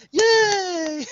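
bright high yay; mock cheer. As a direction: cheer like a kids' show host. Mock celebration.

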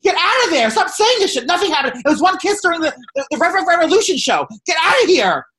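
high-pitched, loud ranting yell; shrieking mock outrage at a TV character; furious shout. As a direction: scream the comeback at the screen. Acting out furious reply tweet.

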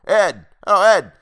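high, loud call to Ed. Acted. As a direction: shout across the inn. Landlord calling the boy.

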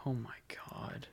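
stunned near-whisper; very soft, whispered shock; hushed shock. Real, not acted. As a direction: breathe it out in hushed disbelief. Shocked reaction to sad news.

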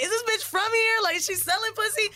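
high, sneering voice; high mocking guy voice. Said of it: "I think he was like".

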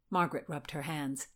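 plain reading voice

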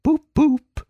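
high-pitched imitation of a motor horn; dreamy high horn noises, not hushed; entranced murmur. A narrator's reading. Dazed Toad echoing the motor car, entranced murmur. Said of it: "he was still heard to murmur".

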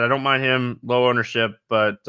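normal talking voice

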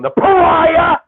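loud, theatrical demand; mock-dramatic shout. Real, not acted. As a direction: belt it out like a big accusation. Mock-dramatic question to co-host.

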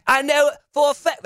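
high, mock British Sharon Osbourne voice; bad British accent. Host mimics Sharon Osbourne with a bad British accent. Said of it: "I have a terrible British accent".

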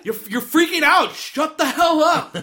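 high-pitched exasperated outburst, hammed up; mock yelling. Imitating Josh telling Megan off, mock yelling. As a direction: snap at her in mock exasperation. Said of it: "yelling at Megan".

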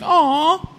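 high, drawn-out mock "aw"; condescending cooing. Host reacts to young brewer's strong beer, mock cooing. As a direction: coo at him as if he were a baby.

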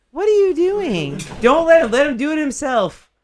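high, loud protest; telling Andrew not to help, loud and high; calling out. Real, not acted. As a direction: shout across the room to stop him. Calls out to stop helper.

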